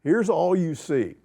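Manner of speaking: usual speaking voice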